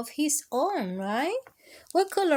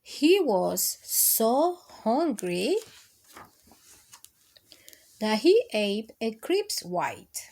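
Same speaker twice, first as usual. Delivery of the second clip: gentle, sing-song picture book reading; lilting read-aloud to kids; reading to children; slower than usual. Acted. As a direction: read it like bedtime story time for little ones.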